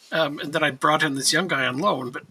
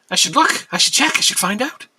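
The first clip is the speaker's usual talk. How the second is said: rising, louder and higher resolve; building excitement; 5 semitones higher, 5 dB louder than usual. Real, not acted. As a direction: talk yourself into it.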